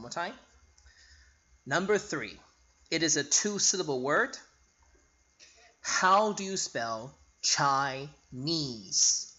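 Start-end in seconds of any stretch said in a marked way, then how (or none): 5.8-9.3 slow quiz question. Shown as a demonstration. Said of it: "teacher quizzing class".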